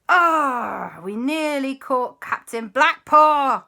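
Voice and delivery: high, slow, drawn-out groan; loud disappointment. Acted. Family laments the ghost's escape, loud dismay. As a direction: stretch out the frustrated "ah".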